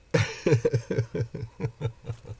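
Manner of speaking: long, high giggling laugh; helpless high chuckling; real amused laugh. Real, not acted. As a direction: laugh freely at your own joke. Amused by own chapter titles.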